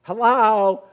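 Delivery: loud, high mock phone yell; talk-yelling. Man yelling hello on speakerphone on a train, mock talk-yelling. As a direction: imitate a man bellowing into speakerphone. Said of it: "he is yelling, he's talk yelling".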